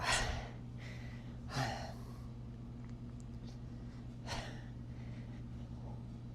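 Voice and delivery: a long, breathy exhale of exhaustion; long tired sigh. Real, not acted. Worn out after a workout, long weary sigh. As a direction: let out a long, spent sigh.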